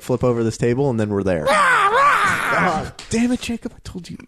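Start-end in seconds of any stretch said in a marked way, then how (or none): 1.5-4.2 high squealing fake anger; squeaky mock outburst. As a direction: shriek it in mock frustration.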